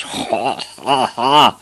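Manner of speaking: hammed-up jeering laugh; loud bully's guffaw; rusty-voiced big boys. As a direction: bellow a mocking "ha, ha, ha". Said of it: "råbte de store drenge med deres dybe, rustne stemmer".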